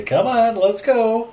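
high, loud coaxing call. Acted. Player coaxing the dragon with meat, high and loud. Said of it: "animal handling and just say".

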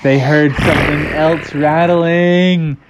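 slow, drawn-out storyteller mystery; suspenseful narration. A narrator's reading.

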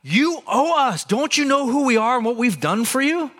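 mock-entitled people accusing God; accusatory. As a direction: throw the complaint back at God like a grievance. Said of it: "This is accusatory".

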